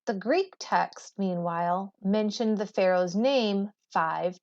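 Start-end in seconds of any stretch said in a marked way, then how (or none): none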